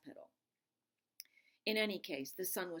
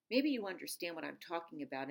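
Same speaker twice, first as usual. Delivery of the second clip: plain reading voice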